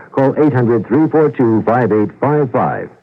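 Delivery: ordinary delivery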